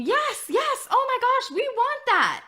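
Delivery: high, excited imitation of eager parents. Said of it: "some of you are thinking".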